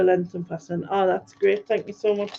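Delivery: normal talking voice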